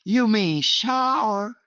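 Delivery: high, slow Southern US drawl stretching "shower"; Southern US accent, the roommate mocking how "shower" was said. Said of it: "he said".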